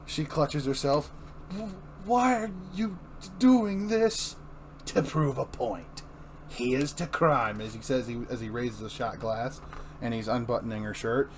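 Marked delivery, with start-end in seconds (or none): 1.5-4.4 frightened plea. Acted. Barbara voiced, frightened. 4.9-7.6 drawn-out sinister toast, slow; slow gloating toast; slow villain toast. Acted. Joker toast, slowed.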